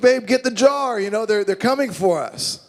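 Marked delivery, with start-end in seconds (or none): 0.0-1.0 high, overwhelmed parent; mock overwhelmed. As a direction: play a parent swamped by kids. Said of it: "You're like".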